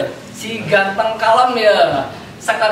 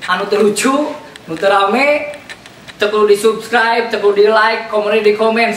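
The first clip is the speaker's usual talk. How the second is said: ordinary talk, nothing marked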